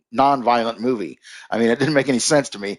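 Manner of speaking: ordinary talk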